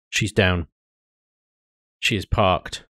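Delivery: usual speaking voice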